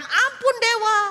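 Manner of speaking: high, loud cry for mercy; terrified plea. Acted. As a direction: beg the god in fear. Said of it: "Mereka tambah ketakutan".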